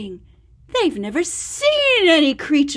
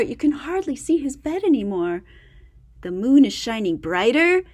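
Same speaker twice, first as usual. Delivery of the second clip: ordinary delivery, nothing marked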